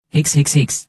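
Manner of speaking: high whimpering mock sobs on the phone; theatrical fake sobbing. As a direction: whine for help with put-on sniffles. Fake crying in women's toilet.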